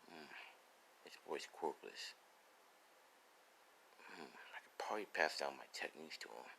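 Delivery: very soft, low murmur; private musing. Acted. Ryu weighs the boy as successor, musing very quietly. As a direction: think aloud almost in a whisper, low and unhurried.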